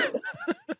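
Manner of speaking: a sudden squeaky laugh at the joke; amused laugh at the t-shirt idea; high burst of laughter. Real, not acted.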